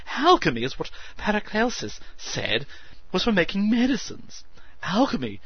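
grand excited oration; orating. A narrator's reading. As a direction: declaim like an orator. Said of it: "he orated".

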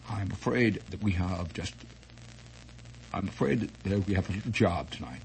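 put-on low, soft vague English accent; murmured English-accented request to his manservant. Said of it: "I always imagined him as speaking with a vague English accent".

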